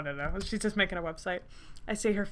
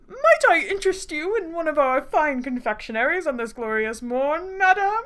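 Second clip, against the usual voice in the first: hammed-up posh waiter, raised and loud; posh accent; 7 semitones higher, 6 dB louder than usual. Playing a fancy waiter offering confectionaries, posh accent. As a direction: put on your best posh accent. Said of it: "best posh accent".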